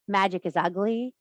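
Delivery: normal talking voice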